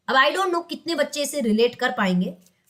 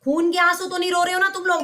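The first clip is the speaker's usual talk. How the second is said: usual speaking voice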